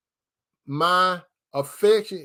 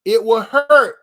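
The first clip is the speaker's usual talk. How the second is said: sudden loud, higher cry of it will hurt; a preacher's loud cry on hurt; preaching shout; 5 semitones higher, 9 dB louder than usual. Real, not acted. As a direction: belt it out to the congregation.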